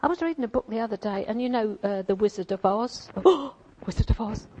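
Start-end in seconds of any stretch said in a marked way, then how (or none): 3.2-4.5 mock shocked gasp. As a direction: play at being scandalised by the title. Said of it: "Should we be talking about such things?".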